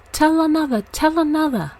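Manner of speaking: put-on high and loud, like clapping children begging; excited pleading cries. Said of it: "clapped their hands and cried".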